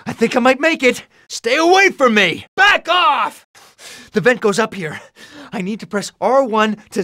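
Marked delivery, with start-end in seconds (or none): 0.1-3.5 high, loud warding-off cries; panicked shout. Acted. Hero fleeing tells pursuer back off, high panicked shout. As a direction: climb into a frantic yell. Hero chased while escaping.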